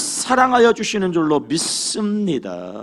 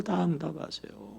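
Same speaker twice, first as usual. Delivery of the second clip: "다 응답하세요" repeated softly, falling; quiet echo; 4 semitones lower, 12 dB quieter than usual. Acted. Preacher repeating to the congregation, soft echo. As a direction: let it fall soft as an echo.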